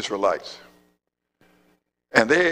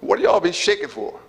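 higher, amused mocking question; David teasing; 7 semitones higher than usual. David mocking the shaking soldiers in a higher voice. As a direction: poke fun at their fear. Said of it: "He said".

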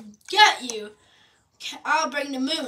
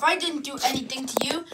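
usual speaking voice

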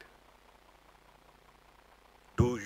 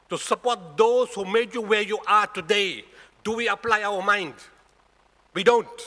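high, loud, emphatic sermon delivery; full-force preaching; 7 semitones higher, 6 dB louder than usual. Real, not acted. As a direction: drive the point home to the congregation.

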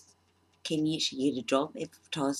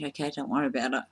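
ordinary talk

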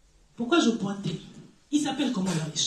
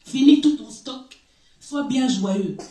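louder ironic command; loud preaching; 7 dB louder, slower than usual. Acted. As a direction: fling it at the rich man. Preaching to the rich man. (ordre ironique lancé avec force)

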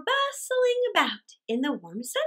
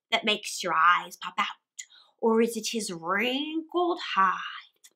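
whisper. Acted. Rat's boast continues.

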